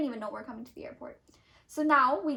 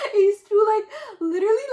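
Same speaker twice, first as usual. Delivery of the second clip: high and loud, laughing through it; laughing at the wagon memory; 8 semitones higher, 11 dB louder than usual. Real, not acted. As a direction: laugh while you tell it. Laughing while telling.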